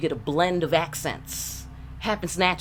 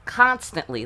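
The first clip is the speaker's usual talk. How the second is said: usual speaking voice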